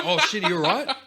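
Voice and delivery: laughing alarmed concern. Retold or imitated. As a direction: rush it out with concern. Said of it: "I'm just like".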